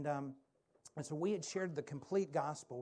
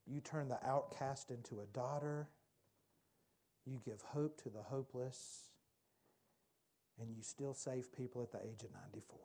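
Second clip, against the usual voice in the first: soft prayer; 3 semitones lower, 3 dB quieter than usual. Real, not acted. Leads the closing prayer.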